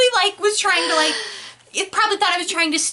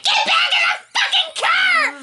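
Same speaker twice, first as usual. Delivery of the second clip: mom screaming; 13 semitones higher than usual. Retold or imitated. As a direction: yell like a mom out the car window. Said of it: "screaming out the window".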